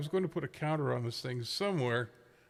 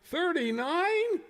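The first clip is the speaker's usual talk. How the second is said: high, slow disbelieving repeat; incredulous; much slower than usual. Real, not acted. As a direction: stretch it out in shock. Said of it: "Would you care to repeat that?".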